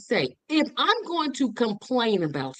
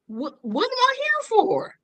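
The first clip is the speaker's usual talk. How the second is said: much higher-pitched incredulous question; exasperated self-questioning; 12 semitones higher than usual. Acted. Citizen questioning own inaction, exasperated. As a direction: throw the question up high, incredulous.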